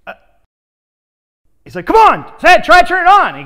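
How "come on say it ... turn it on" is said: loud, high, pushy mentor; mentor getting louder. Retold or imitated. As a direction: push him hard, voice rising loud. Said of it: "his voice got louder".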